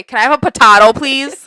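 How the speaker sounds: potato as puh-TAH-toh, loud; mock broad A. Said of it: "I have never heard someone say".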